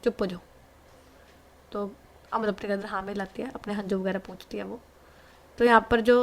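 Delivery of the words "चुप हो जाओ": soft, hushing comfort; quietly calm her down as you stroke her back; a girl soothes her crying niece softly; soothing a crying girl. Acted. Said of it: "पीठ है उसे सहलाती है और बोलती है".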